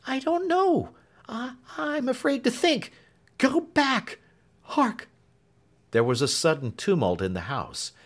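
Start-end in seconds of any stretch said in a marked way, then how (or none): 0.1-5.1 high, trembling woman's voice; whisper-close fear, voice raised high; a scared servant warning him back; frightened maid. Acted. Clemency afraid, voiced high.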